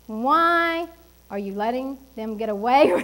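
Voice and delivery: ordinary delivery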